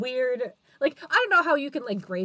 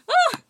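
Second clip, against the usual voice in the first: high, loud cry of "ah"; exclaimed "ah"; 8 dB louder than usual. Real, not acted. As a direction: let out a sudden high yelp. Reacting to relatable line.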